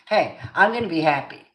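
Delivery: loud, emphatic declaration; declaring it. Shown as a demonstration. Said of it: "you're just declaring it".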